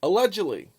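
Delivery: "Allegedly" punched loud and high, then falling; emphatic allegedly. Real, not acted. Vlogger stressing his fairness to viewers, emphatic allegedly. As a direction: lean hard on "Allegedly", pitch leaping up. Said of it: "I emphasize the word allegedly".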